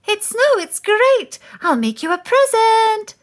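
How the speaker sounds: high, loud excited call. Acted. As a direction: call out gleefully across the snow. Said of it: "called Moose".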